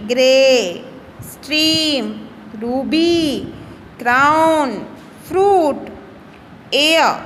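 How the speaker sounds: every "ग्रे", "स्ट्रीम", "रूबी" said word by word with a clear rolled R; R sounded clearly. Shown as a demonstration. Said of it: "आर की sound को notice करना है आपको". (शब्द अलग-अलग बोलो, हर शब्द में आर साफ़ सुनाई दे)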